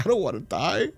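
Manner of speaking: I don't wanna die whined high, with a laugh around it; hammed-up scared little voice; mock whiny plea. Laughing at own heart scare.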